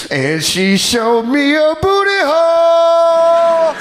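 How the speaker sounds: high, slow shouted punchline; excited shout. Real, not acted. Comedian shouting the strip club punchline, excited. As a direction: belt the payoff in disbelief.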